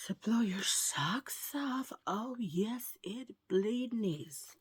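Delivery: slow, soft whispered tagline; whispered catchphrase. Pretend. Vlogger whispering her catchphrase tagline to viewers. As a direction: hush it out like a secret.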